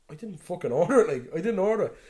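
mock-outraged customer insisting; exasperated protest. Retold burger dispute, customer protesting.

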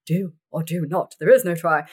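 a Yoda that drifts toward Gandalf; mum's Yoda impression. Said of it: "That sounds more like Gandalf than Yoda".

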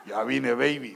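mock macho welcome home; macho greeting. Shown as a demonstration. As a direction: say it strong like a tough guy. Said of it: "tiene que estar durito".

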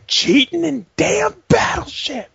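put-on high, slow, indignant kid whine; mock whiny fury. Kid answering his mom, high slow indignant whine. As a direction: whine it like an outraged child.

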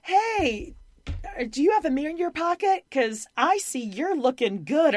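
high, bright mock street compliment; high shouted compliment to a stranger; TikTok hype guy. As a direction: call out a cheesy pickup line, pitched high.

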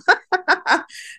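sudden high burst of laughter. Real, not acted. Laughs at own remark.

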